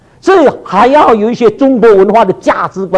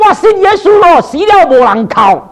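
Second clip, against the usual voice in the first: put-on Taiwanese accent; 6 semitones higher than usual. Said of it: "台湾话你听懂吗".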